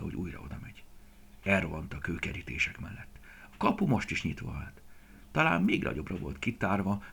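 ordinary delivery, nothing marked

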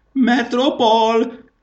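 high, slow loud summons; long, high drawn-out shout; shouted call. A narrator's reading. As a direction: bellow the name across the palace. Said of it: "kiabált mondán".

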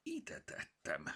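quiet puzzled muttering; muttered to herself. Acted. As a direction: mumble it under your breath, searching. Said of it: "dünnyögött magában Mimi".

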